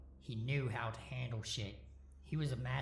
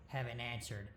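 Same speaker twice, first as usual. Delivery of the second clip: plain reading voice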